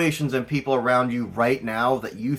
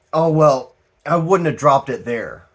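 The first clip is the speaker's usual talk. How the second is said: louder, know-it-all quote of a friend; mock defensive; 7 dB louder than usual. Reader mocking someone who lost something, defensive. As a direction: sound sure of where you left it. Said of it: "they always say".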